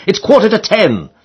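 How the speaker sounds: lightly acted bellow, pitched high; mock roar. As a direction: call out the time with mock urgency. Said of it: "roared Harris".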